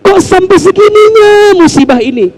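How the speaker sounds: high pleading cry to Allah, voice near breaking; acted weeping prayer; tearful plea to God. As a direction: beg God like someone weeping over their sins.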